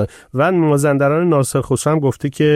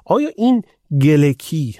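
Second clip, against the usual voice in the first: usual speaking voice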